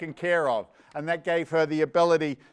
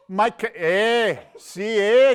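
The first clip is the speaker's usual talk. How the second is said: loud, high, drawn-out Canadian "eh"; terrible Canadian accent; 4 semitones higher, 7 dB louder, much slower than usual. Acted. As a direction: badly fake a Canadian accent, haltingly. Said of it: "I do a terrible Canadian accent".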